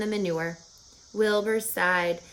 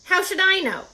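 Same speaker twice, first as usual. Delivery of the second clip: rat's indignant voice; 10 semitones higher, 5 dB louder than usual. Acted. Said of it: "said Templeton".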